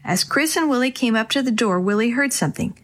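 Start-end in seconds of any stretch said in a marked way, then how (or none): none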